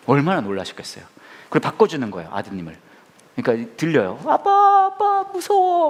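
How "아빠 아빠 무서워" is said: put-on high, frightened child's voice crying for dad; a terrified boy on the phone calling out to his father; scared son. Kidnapped son on the phone to his father, high and frightened. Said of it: "막 그러는 거예요".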